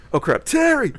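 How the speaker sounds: high loud panicked blurt; suddenly remember in panic; startled outburst. Retold or imitated. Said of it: "Instead of".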